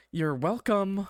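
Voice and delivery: high, pointed sarcastic call. A narrator's reading. Receptionist calling after a departing visitor, sarcastic. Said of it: "sarcastic voice as she fades".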